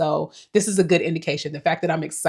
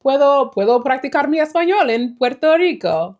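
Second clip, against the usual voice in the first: speaking Spanish with a higher, louder voice; a playful bit of Spanish practice; practicing Spanish for the Puerto Rico trip; showing off Spanish; 7 semitones higher, 4 dB louder than usual. Shown as a demonstration. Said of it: "practice my Spanish".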